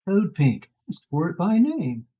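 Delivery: loud, upbeat pitchman tagline; chipper 1950s ad. Pretend. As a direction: sell it like a cheesy ad. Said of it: "the worst Christmas commercial ever".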